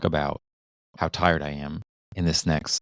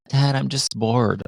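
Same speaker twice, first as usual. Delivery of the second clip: put-on higher, slow, drawn-out kid whine; whiny kid; 5 semitones higher, slower than usual. Kids complaining to their dad that they're bored, mock whine. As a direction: mimic a bored child complaining. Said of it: "Every time they're like".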